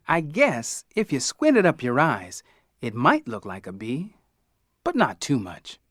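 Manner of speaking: ordinary delivery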